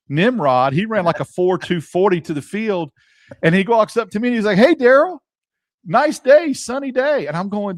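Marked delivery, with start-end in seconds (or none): 4.6-7.3 high, loud, chirpy imitation of the reporter; cheery mock greeting. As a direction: ham up his breezy small talk.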